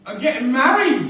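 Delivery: loud, bursting repeat of the news; shouting it out with joy; jubilant announcement. Acted. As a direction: let the news explode out.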